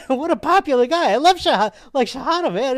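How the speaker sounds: high, loud fan-like gushing; clueless enthusiasm. Retold or imitated. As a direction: rave as if about a rock star. Mistakes Shahada for a popular person.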